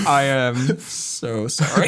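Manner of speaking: a very slow, drawn-out mock apology. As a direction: stretch each word out, mock sorry. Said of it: "And I was like".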